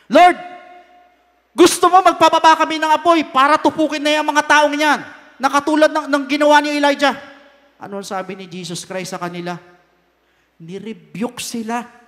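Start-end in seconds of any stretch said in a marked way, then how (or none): 0.1-7.1 triggered disciples. Acted. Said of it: "Na-trigger po si James tsaka si John".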